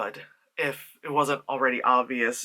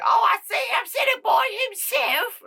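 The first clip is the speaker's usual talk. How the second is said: much higher, mock-posh British accent; hammed-up British girl voice; 3 dB louder than usual. Reviewer redoing Shelley's line in a British accent. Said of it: "oh wait, no, she's British".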